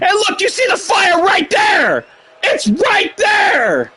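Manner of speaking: high shout, slowing on It's right there; incredulous shout. Real, not acted. Pointing at fire in disbelief.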